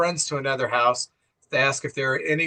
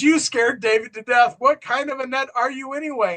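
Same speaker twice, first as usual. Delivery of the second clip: high-pitched mock outrage; retold angry man; 9 semitones higher than usual. Angry dad confronting the woman at his door, mock indignant. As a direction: imitate an angry father scolding at the door. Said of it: "an angry man answers the door".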